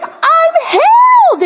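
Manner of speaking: whooping imitation, very high and loud; excited healed man. Plumber celebrating his healed shoulder to the pastor, excited yell. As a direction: stretch it slowly into a jubilant yell. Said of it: "He was so excited".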